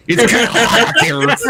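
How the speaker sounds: high, loud shouted put-on voice; Richard Dolan voice. Prank Alexa answering in Richard Dolan's loud high voice. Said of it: "the voice of Richard Dolan".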